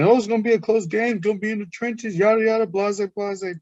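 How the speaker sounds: high, sing-song mock of pundit chatter. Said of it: "Everybody was saying".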